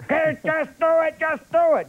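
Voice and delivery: high-pitched mock bark of a cigar-chomping director; impatient director's yell. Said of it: "he's a screaming, yelling guy".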